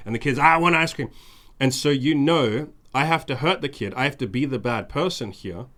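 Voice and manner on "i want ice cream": put-on high, loud toddler demand; mock child tantrum; whiny child. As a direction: yell it like a kid refusing bed. Kid demanding ice cream.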